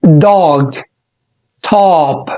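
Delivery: "dog" and "top" with an open ah; model pronunciation. Shown as a demonstration. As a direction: say them with an open mouth. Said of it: "أو كلمة". (نطق dog و top)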